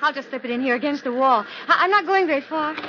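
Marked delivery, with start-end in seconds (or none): none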